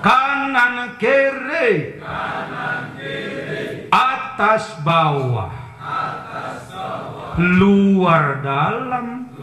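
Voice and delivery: kata demi kata dipanjangkan pelan; diucapkan perlahan. Shown as a demonstration. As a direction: eja perlahan agar jemaah ikut. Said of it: "Yuk ngomong". (kiai mengucapkan pelan-pelan untuk ditirukan jemaah)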